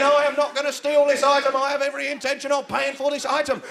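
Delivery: high, loud public announcement; loud proclamation. Acted. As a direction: declare it to the whole shop. Announcing innocence to whole supermarket.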